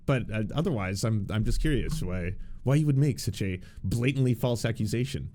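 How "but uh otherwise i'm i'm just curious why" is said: ordinary talk, nothing marked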